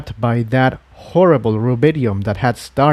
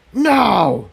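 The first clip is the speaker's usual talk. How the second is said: shout; 9 semitones higher than usual. Acted. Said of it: "the voice shouted".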